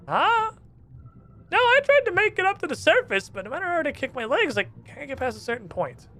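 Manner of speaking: chirpy surprised underwater stranger; squeaky NPC. Acted. Trapped islander greets the first visitor, high squeaky.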